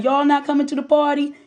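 high, teasing call; joking taunt. Retold or imitated. Neighbors ribbing the locked-down sisters, joking taunt. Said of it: "with mad jokes".

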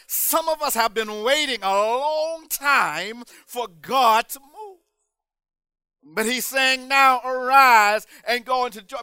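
impassioned preaching. Acted. Preacher urging the congregation to arise and go over, impassioned preaching.

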